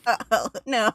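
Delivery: high whiny not again; mock dread. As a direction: whine it like a caught kid. Mock dread at being caught.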